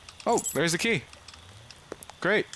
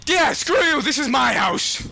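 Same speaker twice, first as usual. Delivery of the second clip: high, loud angry shouting at a ghost; furious frightened yelling; defiant yelling; 12 semitones higher, 6 dB louder than usual. Real, not acted. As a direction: scream back to stop being scared.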